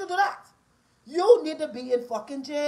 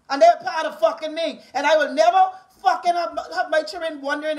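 loud, raised, forceful rant; angry warning; 3 semitones higher, 5 dB louder than usual. Real, not acted. Mother claiming her children as her responsibility, angry. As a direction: punch out each claim with fierce insistence.

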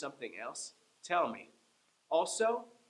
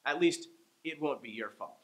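usual speaking voice

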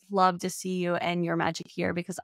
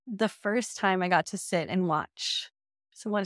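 ordinary talk, nothing marked

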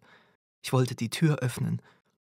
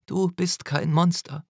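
put-on quoting the girl; 6 semitones higher, 3 dB louder than usual. A narrator's reading. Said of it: "zitierte er das Mädchen".

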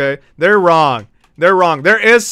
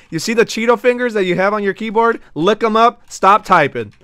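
ordinary talk, nothing marked

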